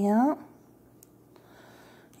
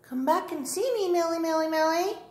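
calling the cat; 9 semitones higher than usual. Real, not acted.

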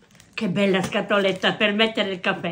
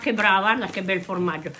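usual speaking voice

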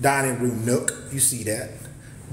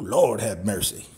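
usual speaking voice

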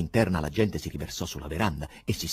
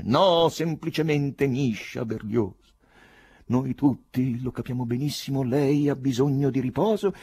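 raised, declamatory soothing speech; political rally voice; 6 semitones higher, 4 dB louder than usual. A narrator's reading. Fleshy-faced man urging poet to rest, oratorical. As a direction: orate as if from a platform. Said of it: "con una voce da comizio".

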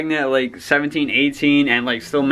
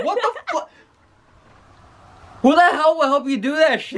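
much higher, stunned and indignant; shocked outrage; 12 semitones higher than usual. Real, not acted. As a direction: yell it in disbelief. Reacts to the prank reveal.

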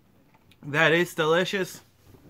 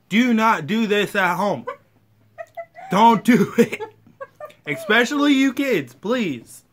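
raised, higher stern warning; loud warning; 7 semitones higher, 6 dB louder than usual. Real, not acted. Warning kids not to try.

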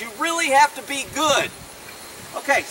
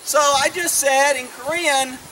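ordinary talk

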